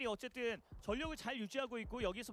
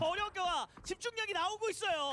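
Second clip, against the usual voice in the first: excited; 9 semitones higher, 6 dB louder than usual. Real, not acted. Caster builds hype on the fight.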